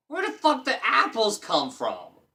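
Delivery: high, loud disbelief; surprised high outburst; baffled outburst. Real, not acted. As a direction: blurt out in confusion. Confused by text.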